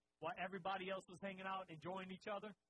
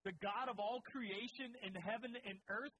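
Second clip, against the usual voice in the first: usual speaking voice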